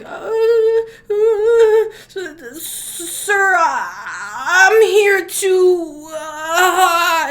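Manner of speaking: high, shaky stammering with near sobs; terrified stammer. Acted. Person who woke the entity stammers to it, terrified and tearful. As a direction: stutter out an apology in pure fright. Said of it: "And this person's like".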